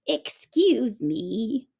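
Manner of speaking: put-on slow, sweet old woman voice; a kindly grandma politely stepping in; bright and sweet. A narrator's reading. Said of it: "she said brightly".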